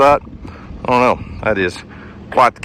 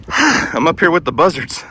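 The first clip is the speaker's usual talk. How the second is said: out of breath; 2 semitones higher than usual. Real, not acted. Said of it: "I'm sorry that I'm breathing so hard".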